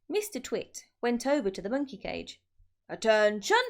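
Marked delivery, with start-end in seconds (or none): 2.9-3.6 loud, fierce trainer's bark; barked command. A narrator's reading. Mister Twit commands the caged monkeys, loud fierce bark. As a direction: bark it like a drill sergeant. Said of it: "he barked in his fearsome monkey trainer's voice".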